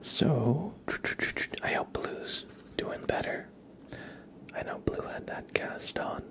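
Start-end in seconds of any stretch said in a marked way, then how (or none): none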